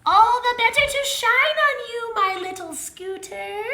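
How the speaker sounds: put-on high, creaking old granny voice; creaky fake granny. Tank in disguise answers Little Red, high creaky granny voice. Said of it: "creaked Tank".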